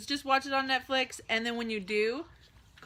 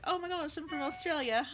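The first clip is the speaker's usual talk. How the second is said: higher-pitched mock Australian accent; badly faked Australian pick-up line; 6 semitones higher than usual. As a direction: put on a rusty Australian accent. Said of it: "I'd say I was Australian".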